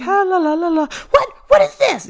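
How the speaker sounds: high sing-song, then startled; oblivious ghost. Retold or imitated. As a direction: hum carelessly, then startled. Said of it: "You'd just be like".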